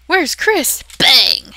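loud, high mock call then a bang; playful yell and bang; mock dramatic shout. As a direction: shout it like a game moment. Acting game scene.

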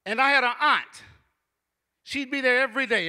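ordinary delivery

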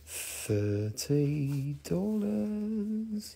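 very slow, hushed, stretched words; drawn-out price tease. Pretend. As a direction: stretch THIRTY DOLLARS out long and softly. Price repeated as teasing hurry-up.

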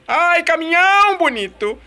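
slow, high mock praise; sarcastic cry. Real, not acted. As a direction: fake admiration for the truck. Truck blocks him in race.

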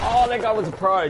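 surprised. Real, not acted. Reacting to a skilled enemy.

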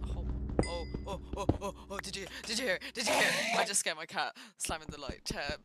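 usual speaking voice

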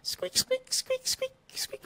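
tiny, very high whispered mouse squeaks; squeaking mouse. Acted. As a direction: squeak like a little pet mouse. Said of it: "actually got Geronimo over here".

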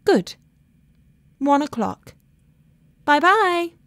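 bright high telephone goodbye; phone sign-off. A narrator's reading. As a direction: wrap up the call cheerily. Laura ends the phone call.

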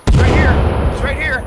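urgent shouted callout; grenade warning yell. Real, not acted. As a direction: shout to warn your partner.

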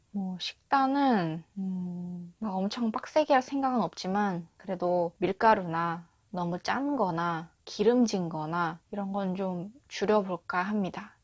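normal talking voice

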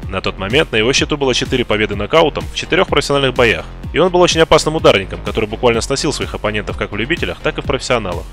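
ordinary delivery

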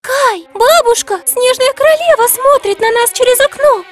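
put-on high, loud startled little girl; frightened child. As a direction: cry out in alarm like a child. Girl sees queen at window.

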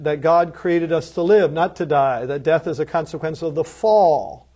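ordinary talk

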